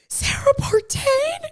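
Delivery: high, stretched-out shriek of a name; surprised scream. Retold or imitated. Spotting her best friend as photographer, high shocked squeal. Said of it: "And I start screaming".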